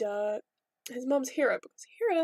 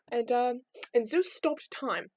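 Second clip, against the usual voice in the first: ordinary delivery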